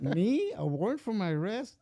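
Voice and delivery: a mock-shocked young man hearing he's under arrest; shocked disbelief. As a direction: replay the shock of being told. Retold shock at arrest warrant.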